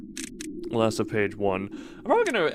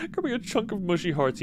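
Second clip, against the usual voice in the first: higher, giggling retake of the line; laughing through it; 6 semitones higher than usual. Real, not acted. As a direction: crack up while reading it.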